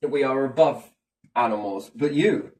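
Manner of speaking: usual speaking voice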